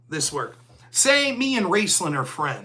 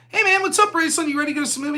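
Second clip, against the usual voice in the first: much higher, louder friendly hello; cheery greeting; 8 semitones higher, 3 dB louder, much faster than usual. Retold or imitated. Greeting friend Raceland in the parking lot, excitedly upbeat. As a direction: greet your buddy like you're pumped for the movie.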